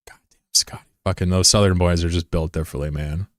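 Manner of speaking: ordinary talk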